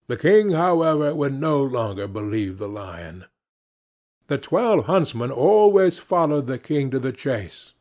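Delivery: usual speaking voice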